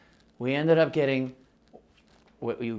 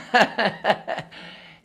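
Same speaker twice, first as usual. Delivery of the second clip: embarrassed laugh; 10 semitones higher, 6 dB louder than usual. Real, not acted. Laughs at own accent.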